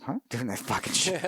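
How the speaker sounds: scoffing disbelief, a touch put-on; mock incredulous Iraqi. Iraqi soldiers scoffing at the Fox News request. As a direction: sneer at the very idea.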